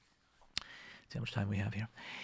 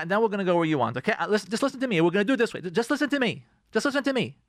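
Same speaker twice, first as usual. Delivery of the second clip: insistent, pitched-up bossing; acted controlling spouse; 4 semitones higher, faster than usual. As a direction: talk over your spouse and take charge. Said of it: "take control of the situation".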